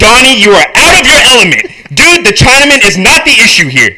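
loud, high, exasperated tirade; acted furious put-down; angry shouting. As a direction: yell him down. Walter shouts Donnie down in script.

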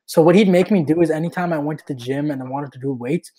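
ordinary delivery

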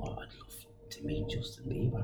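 soft unvoiced whisper of a wish. Father whispering his wish to his daughter's mum, softly whispered. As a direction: breathe the wish under your breath, voice off. Wishing aloud to her mum.

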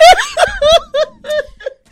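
high, loud burst of laughing; helpless laughing fit; loud laughter. Real, not acted. Reacting to the cat story.

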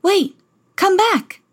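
high, loud, slow plea; prince calling after her. Acted. Prince calls after fleeing Cinderella, loud and pleading. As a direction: call out after someone running away. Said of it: "called the prince".

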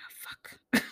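hushed, grumbling curse; whispered curse. Retold or imitated. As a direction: mutter it under your breath. Bruce voiced, whispered curse.

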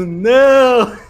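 a cheerful, jokey "Não"; tossing off a laughing "Não"; mock denial. Retelling his answer to the group, a laughing denial. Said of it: "E eu digo".

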